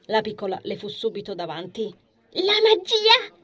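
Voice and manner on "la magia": high, loud, drawn-out squeal; shrieky child excitement, stretched out; excited child shout. A narrator's reading. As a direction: demand it like an impatient little girl. Impatient child demands the magic.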